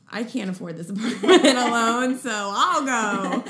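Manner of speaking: laughing through a hammed-up quote; mock self-quote, laughing. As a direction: retell your own outburst, laughing loudly. Retells angry resigned decision, laughing.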